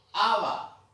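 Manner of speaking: Aba called out high and loud; teacher's model word. Shown as a demonstration. (palabra modelo Aba, dicha fuerte y aguda)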